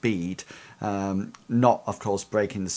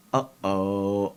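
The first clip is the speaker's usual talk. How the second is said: a light, knowing uh-oh. Acted. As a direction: toss off a small uh-oh. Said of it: "like that".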